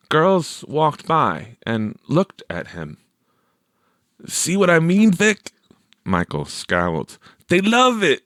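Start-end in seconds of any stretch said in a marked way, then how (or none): 4.3-8.2 higher, louder lightly voiced friend; a hint of a smug teenage boy; Michael's voice. A narrator's reading. Michael boasting to Vic, higher and louder.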